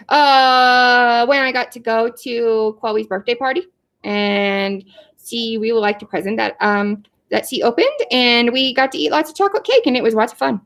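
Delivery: put-on childlike puppet voice, recounting a happy memory; puppy character telling about a party. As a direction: talk like an eager little pupil.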